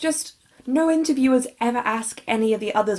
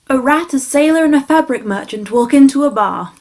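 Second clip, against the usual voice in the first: loud, projected joke setup; booming stage delivery of a gag opener; jester telling a joke; 3 semitones higher, 8 dB louder than usual. Shown as a demonstration. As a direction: announce it to the whole court.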